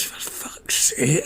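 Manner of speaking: slow, breathy whisper of dismay; whispered swear. Retold or imitated. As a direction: whisper it in disbelief under your breath. Muttering dismay to himself.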